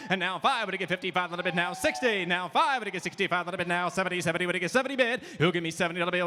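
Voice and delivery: rapid rhythmic auction chant; auctioneer. Acted. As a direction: rattle off the bids in a fast sing-song chant. Second lot auction bid calling.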